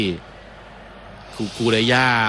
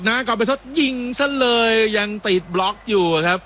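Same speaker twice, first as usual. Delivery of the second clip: higher-pitched call of a shot; live play-by-play; 9 semitones higher than usual. Real, not acted. Commentator calling a blocked shot to viewers, raised play-by-play voice. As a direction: lift the pitch on the shot, then ease down.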